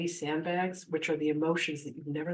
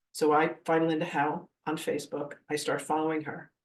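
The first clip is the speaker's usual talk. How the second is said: ordinary talk, nothing marked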